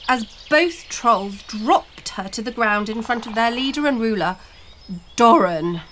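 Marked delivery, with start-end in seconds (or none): none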